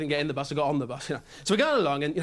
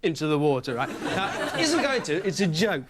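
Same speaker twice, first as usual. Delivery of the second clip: ordinary talk, nothing marked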